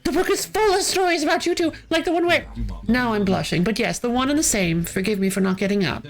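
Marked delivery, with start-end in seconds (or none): 0.0-1.5 can't believe he's brushing it off; gushing disbelief. Acted. Fan gushes at the caged sidekick, amazed.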